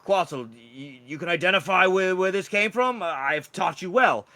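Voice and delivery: put-on proud old teacher addressing a pupil; Mage Hookjaw. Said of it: "Mage Hookjaw stands in the room and looks to Quetzal".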